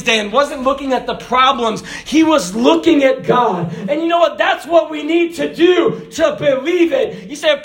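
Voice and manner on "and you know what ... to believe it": full preaching. Real, not acted.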